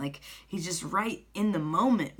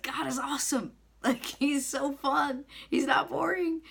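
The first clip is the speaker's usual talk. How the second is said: high-pitched, excited enthusiasm; retold gushing; 9 semitones higher than usual. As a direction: gush with delight, pitch way up. Retold gushing about God to clerk.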